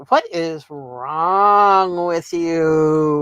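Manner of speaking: imitating her own reaction while listening; incredulous. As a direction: drag it out in mock disbelief. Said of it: "I'm going".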